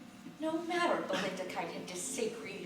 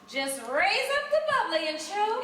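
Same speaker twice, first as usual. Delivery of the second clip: much higher, loud, cutting command; snapped order; 11 semitones higher, 9 dB louder than usual. Acted. Belinda ordering the guests to drink, a loud high snap. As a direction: bark the toast order down at them. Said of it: "Belinda Kite snapped".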